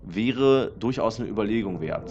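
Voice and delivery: ordinary delivery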